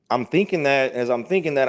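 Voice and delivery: ordinary delivery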